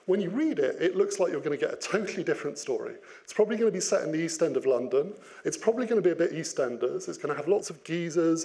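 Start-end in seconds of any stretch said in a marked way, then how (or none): none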